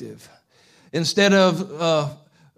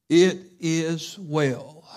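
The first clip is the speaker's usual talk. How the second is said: slow and deliberate; much slower than usual. Acted. Slow emphatic pulpit statement.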